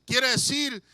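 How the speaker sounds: ordinary talk, nothing marked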